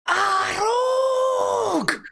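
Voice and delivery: loud high shout of pain; pained war cry. Acted. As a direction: yell out like a wounded soldier. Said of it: "another one goes".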